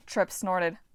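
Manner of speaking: ordinary delivery, nothing marked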